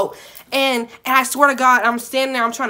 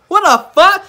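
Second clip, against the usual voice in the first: loud, high what-the-fuck; outraged disbelief; 8 semitones higher, 10 dB louder, slower than usual. Real, not acted. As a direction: blurt it out, appalled. Shocked at customer's proposition.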